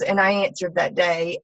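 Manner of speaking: normal talking voice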